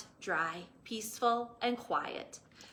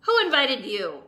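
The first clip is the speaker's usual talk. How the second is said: louder annoyed snap; grumpy; 2 semitones higher, 10 dB louder than usual. Acted. Cactus snapping at a lizard. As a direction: grump at the visitor. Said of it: "said Hank".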